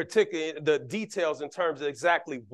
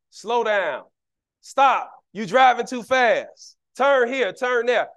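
bossy backseat driver, raised and sharp; mock backseat driver calling out orders; 6 semitones higher, 5 dB louder, slower than usual. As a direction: nag the driver from the passenger seat.

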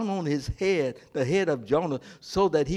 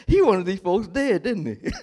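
laughing through the line; 4 semitones higher, 3 dB louder than usual. Real, not acted. Preacher laughing at Jonah's grudge, chuckling loudly. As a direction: chuckle as you say it.

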